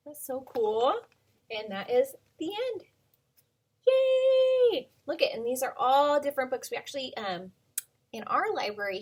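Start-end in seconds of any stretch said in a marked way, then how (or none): 3.9-4.9 high, loud happy cheer. Real, not acted. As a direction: celebrate the end of the book. Cheering at end of book.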